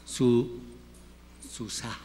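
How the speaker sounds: ordinary talk, nothing marked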